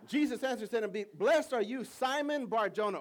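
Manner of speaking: loud, high proclaiming read; preached scripture. Acted. Pastor reading Peter's answer aloud, loud proclaiming. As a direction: proclaim it to the room.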